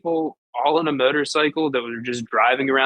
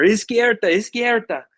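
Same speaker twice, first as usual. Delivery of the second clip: loud, higher urgent call in Spanish; retold yell; 8 semitones higher, 3 dB louder than usual. Telling a Mexican cab driver to turn left, loud urgent call. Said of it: "I just had to scream at this taxi driver".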